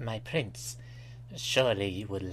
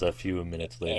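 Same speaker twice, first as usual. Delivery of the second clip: dramatic narrator; 3 semitones lower, faster than usual. Pretend. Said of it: "I wanna do all those".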